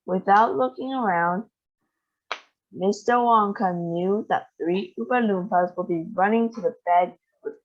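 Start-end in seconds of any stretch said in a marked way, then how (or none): none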